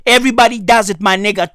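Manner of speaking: normal talking voice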